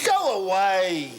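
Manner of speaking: slow, stretched shooing call; drawn-out call. Acted. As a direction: stretch out both words as you send them off. Man shoos someone off, drawn out.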